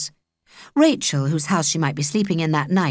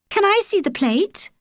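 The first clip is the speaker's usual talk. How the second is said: eager high ask; 13 semitones higher than usual. Acted. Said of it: "Holly said".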